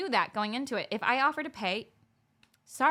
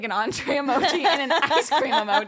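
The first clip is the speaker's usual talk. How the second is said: laughing through the words; can't get it out without giggling; real disbelieving laughter, louder; 5 semitones higher, 7 dB louder than usual. Real, not acted. Laughing at the absurd request.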